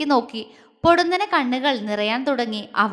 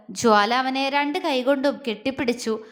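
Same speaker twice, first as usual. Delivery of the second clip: plain reading voice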